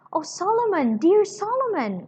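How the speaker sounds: a sister overjoyed to find her brother; crying out. Acted. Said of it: "cried Miss Pross".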